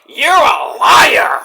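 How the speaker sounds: loud, high, drawn-out shout; shouted accusation. Retold or imitated. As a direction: hurl it like a wrestling promo. Retold wrestler's accusation.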